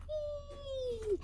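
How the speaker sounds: high, soaring whee of joy; gleeful whee. Acted. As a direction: squeal it like bouncing high. Kitten bouncing on bed.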